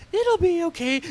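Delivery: high, sweet mocking girl voice; mock soothing girl. Reviewer imitating Cindy comforting Betsy, high and sweet. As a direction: coo it in a high put-on girl voice. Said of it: "and she goes".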